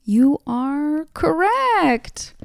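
slow, drawn-out host reveal; game show host. Pretend. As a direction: announce it like a quiz host. Quiz host reveals the answer.

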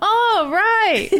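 laughing echo of the imitation; oh right echo. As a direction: repeat the line back, laughing. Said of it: "you're like".

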